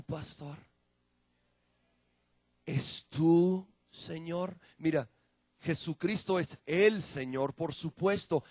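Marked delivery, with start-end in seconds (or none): none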